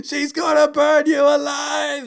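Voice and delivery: high, loud, slow mock scream; imitated dad shouting. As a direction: shriek the warning as a hammed-up dad. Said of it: "He gets out of the car, he's like".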